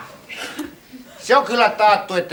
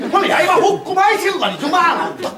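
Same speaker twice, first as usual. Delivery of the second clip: laughing exclaim; 10 semitones higher than usual. Acted. Drowning in that much water.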